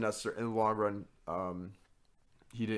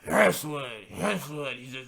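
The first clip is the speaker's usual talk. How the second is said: slurred mumbled answer; mock drunk mumble; 5 semitones higher, 5 dB louder, slower than usual.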